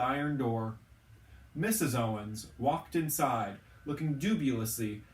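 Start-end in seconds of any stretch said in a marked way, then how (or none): none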